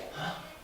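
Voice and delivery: sharp soft intake of breath; imitated startled gasp. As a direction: gasp like someone suddenly frightened. Acting out scared locals.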